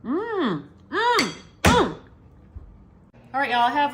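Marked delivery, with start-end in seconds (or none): 0.0-2.0 high, appreciative tasting hum; savoring mm. Real, not acted. Cook tasting the food she made, savoring hum. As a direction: hum with pleasure at a bite.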